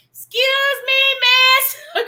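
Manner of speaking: high, loud call breaking into laughter; hammed-up high-pitched holler; retold calling out. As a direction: shout across to a stranger, laughing. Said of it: "So I had to break accent".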